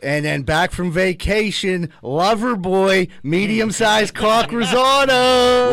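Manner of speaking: slow, loud ring announcer; grand entrance announcement. Pretend. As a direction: stretch every word like fight night. Boxing-style intro of a guest.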